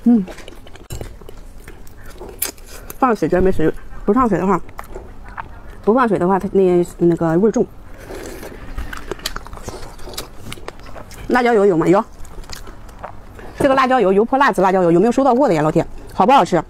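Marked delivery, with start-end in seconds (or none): 0.0-12.0 speaking between bites; talking while chewing. Real, not acted. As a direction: mouth partly full. Seen 0: Eats continuously before, during, after; fingers to mouth at 1.0–1.6s.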